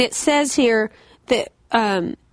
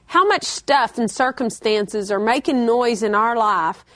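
ordinary talk, nothing marked

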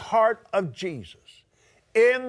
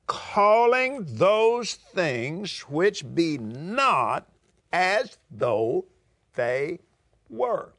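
slow; 2 semitones lower, much slower than usual. Shown as a demonstration. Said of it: "Now, this would be calling things".

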